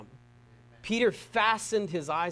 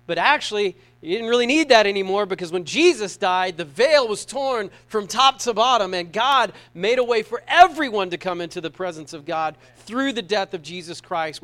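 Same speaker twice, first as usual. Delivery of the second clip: preaching; 7 dB louder than usual. Acted. Preaching at full force.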